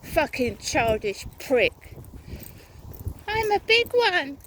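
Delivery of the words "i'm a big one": higher mocking sing-song on "big"; mocking quote. Woman mimicking her harasser's boast in a high mocking voice. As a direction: parrot his words back to ridicule him.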